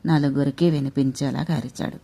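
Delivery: ordinary delivery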